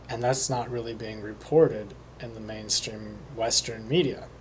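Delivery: normal talking voice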